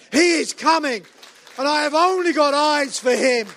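fervent pulpit proclamation building in force; impassioned preaching. Acted. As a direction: proclaim it to the whole church, carried away.

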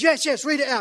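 high, loud impatient urging; insistent yes. Retold or imitated. Father insisting the verse be read, high and loud. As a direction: push him to read it now. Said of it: "And he goes".

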